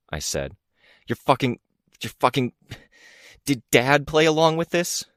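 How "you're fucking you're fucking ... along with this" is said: high strained upset. Acted.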